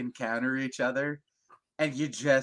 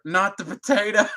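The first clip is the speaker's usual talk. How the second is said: mock last words; 8 semitones higher, 8 dB louder than usual. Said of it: "His last words were".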